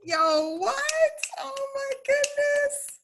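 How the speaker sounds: high-pitched amazed outburst; amazed reaction. Real, not acted. Host reacts to a poem just performed, amazed. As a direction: react blown away, voice shooting up high.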